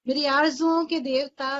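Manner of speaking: emphatic recitation. Shown as a demonstration. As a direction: declaim the repeat with force. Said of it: "repeats the line".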